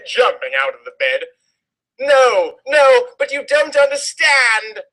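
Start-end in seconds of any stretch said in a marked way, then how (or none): 2.0-4.8 high hammed-up pleading Draco. A narrator's reading. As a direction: beg in a mock whiny high voice. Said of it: "Draco pleaded".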